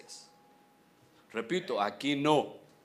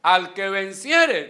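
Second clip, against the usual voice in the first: "Al que venciere" called out slow and loud; loud scripture quote; 2 semitones higher, 10 dB louder, slower than usual. Acted. Preacher proclaiming the promise to the church, loud and slow.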